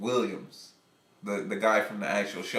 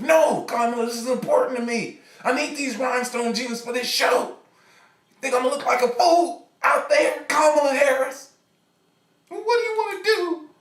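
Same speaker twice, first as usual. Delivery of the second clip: higher and louder, a petulant complaining singer; whiny boyfriend rant; 6 dB louder than usual. Acted. Struggling singer whines at Kamala about torn jeans. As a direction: whine it out like a frustrated musician. Imagined Montel ranting at Kamala.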